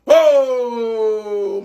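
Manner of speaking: long drawn-out moaning "oh"; wailed lament. Acted. Fan mourns a ruined World Cup, long wail. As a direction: let out one endless groan of despair.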